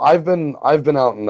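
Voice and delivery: usual speaking voice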